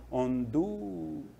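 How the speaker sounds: "Honduras" with "du" drawn out long; slow down and hold the "du" of "Honduras"; stress on DU. Shown as a demonstration. Teacher pointing out the penultimate syllable, stretching "du" in "Honduras". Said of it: "no du".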